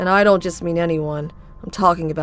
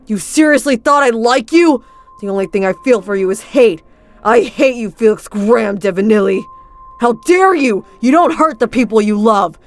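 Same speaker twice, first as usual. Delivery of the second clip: louder, raised furious rant; angry outburst; 5 dB louder than usual. Acted. Luca turns on Felix, furious and loud. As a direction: hurl the hate at him.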